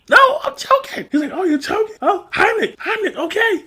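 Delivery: very high, panicky choking voice; mock panicked squeal. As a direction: squeal it in a frenzy. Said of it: "Keenan looks at him like".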